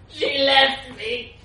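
slow, loud, high self-pitying moan; hammed-up heartbroken wail to the policeman. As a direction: wallow in it theatrically. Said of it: "he moaned".

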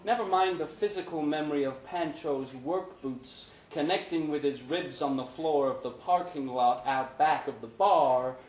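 ordinary delivery, nothing marked

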